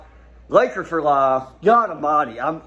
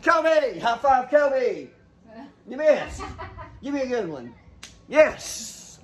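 much higher, giddy and breathless; real excitement; 8 semitones higher than usual. Real, not acted. Father celebrating the draft pick with his son, giddy excitement.

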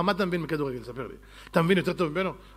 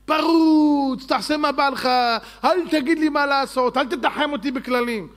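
high, raised voice rejecting all rules; defiant outburst; 4 semitones higher, 3 dB louder than usual. Acted. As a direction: burst out like someone who won't be told. Acting out a life without limits.

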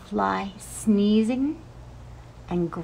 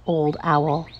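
ordinary delivery, nothing marked

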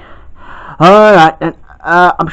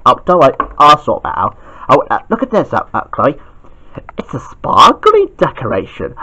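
ordinary talk, nothing marked

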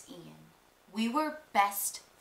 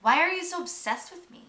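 put-on higher, louder snotty teen comeback; snotty retort; 7 semitones higher, 5 dB louder than usual. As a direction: toss it back with disdain. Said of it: "And I'd be like".

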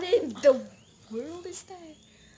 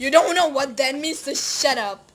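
louder, irritated retort; annoyed shouting; 2 semitones higher, 9 dB louder than usual. Real, not acted. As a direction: snap back at the phone. Yelling at Siri.

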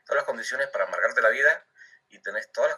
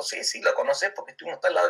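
higher, insistent mock friend; lightly raised friend voice insisting; imitating a friend; 9 semitones higher than usual. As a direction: push it up high and insist. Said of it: "friend insists in retold chat".

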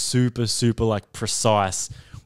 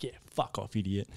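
muttered brush-off; 3 semitones lower, 13 dB quieter than usual. Retold or imitated. Brushing off a mate's teasing.

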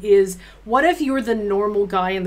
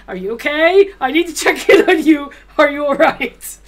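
louder and higher fretful mom check-in; worried mom; 6 semitones higher, 8 dB louder than usual. Retold or imitated. Host to Cassandra, louder higher worried mom voice. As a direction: fuss over her like a worried mom. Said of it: "my full stream mom vibes would kick in".

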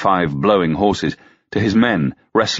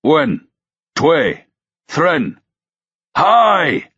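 high calling count, building to a shout; yelled count; 9 semitones higher, 4 dB louder, much slower than usual. A narrator's reading. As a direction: count off the heave, climbing to a yell. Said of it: "Ruck yelled".

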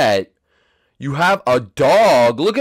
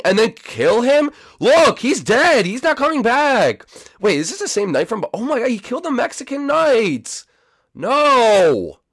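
high, exclaiming protest; high-pitched, appalled rant; outraged disbelief; 8 semitones higher than usual. Real, not acted. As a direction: cry out in comic outrage. Outraged reaction to a killing.

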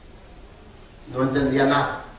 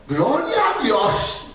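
copying the sister; 8 semitones higher, slower than usual. Retold or imitated. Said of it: "Ella comenzó a copiar".

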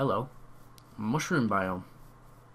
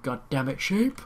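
exasperated outburst; 2 semitones higher, 6 dB louder than usual. Real, not acted. Player fed up with finding only sheep, exasperated. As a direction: burst out in frustration. Sheep keep appearing instead of pigs.

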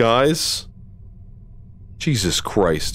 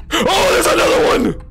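high startled exclaim; 13 semitones higher than usual. Real, not acted. More monsters appear.

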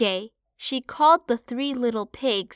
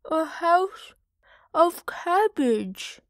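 higher, slow piggy voice through food; greedy piglet; 7 semitones higher, slower than usual. Acted. Parlo answering mother while eating, slow and high. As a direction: mouth full, dreamy about food. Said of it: "answered Parlo".

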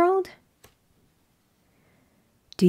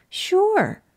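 cheery sure; 9 semitones higher than usual. Acted. Piggie answers brightly.

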